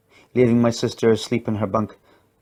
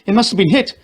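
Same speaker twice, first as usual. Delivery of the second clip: much higher, louder, alarmed; shouted; 11 semitones higher, 6 dB louder than usual. A narrator's reading. Companion fears the station was bombed, louder alarmed shout. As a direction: cry out the disaster. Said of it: "shouted her companion".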